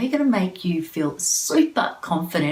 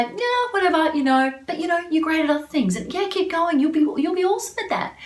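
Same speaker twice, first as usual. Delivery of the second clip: much higher, upbeat encouraging friend; supportive cheerleader; 11 semitones higher than usual. Retold or imitated. Cheering on a best friend who forgot details, high and upbeat. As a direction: lift your friend up with bright pep. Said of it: "Or would you say".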